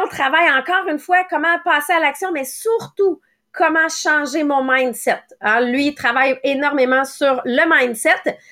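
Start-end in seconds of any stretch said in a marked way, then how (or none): none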